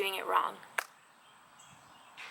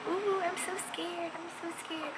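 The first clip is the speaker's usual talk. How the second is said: hammed-up little scare, pitched up; mock scared; 9 semitones higher than usual. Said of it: "So I'm scared".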